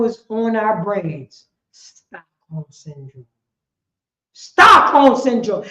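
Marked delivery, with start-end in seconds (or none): 1.7-3.3 hushed. Real, not acted. Repeats the phrase, first hushed. 4.3-5.6 emphatic. Real, not acted. Repeats the phrase, then loud.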